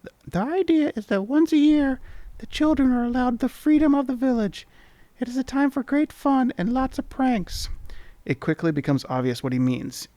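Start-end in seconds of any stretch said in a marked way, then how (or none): 0.0-7.7 much higher, hushed-breathy sprite voice; conspiratorial tiny creature. Acted. Mini mite explaining the festival to the traveller. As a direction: lean in and let the secret out.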